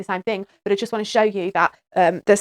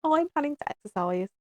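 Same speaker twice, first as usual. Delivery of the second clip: proud claim; 7 semitones higher, 4 dB quieter than usual. Pretend.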